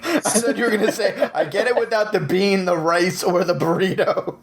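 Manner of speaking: high, laughing mock quote; laughing tease. Real, not acted. Friends joking about a burrito order, laughing.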